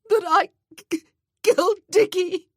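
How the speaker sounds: high, loud, broken wail; keening cry, voice cracking. A narrator's reading. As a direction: sob the confession out as she breaks down. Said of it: "she wailed".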